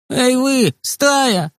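put-on high, begging boy's voice; pleading. A narrator's reading. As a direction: plead from behind the fence. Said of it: "взмолился Костик из-за забора". (высоким голосом умоляюще)

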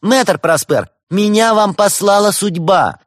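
high, loud, drawn-out cry; calling out. A narrator's reading. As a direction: call out grandly across a room. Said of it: "выкрикивая на ходу".

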